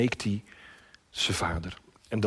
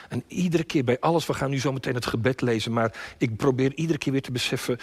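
ordinary talk

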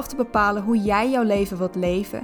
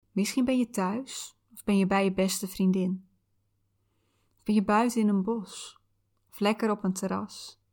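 calm soothing visualization guide. Acted.

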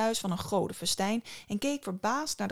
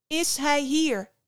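called out higher and louder, slow; calling after; 7 semitones higher, 6 dB louder, slower than usual. Acted. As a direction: shout after someone walking away. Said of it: "riep Psyche haar achterna".

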